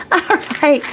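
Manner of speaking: high, helpless laughter; laughing fit. Real, not acted. Host laughing at herself for chat, high laughter.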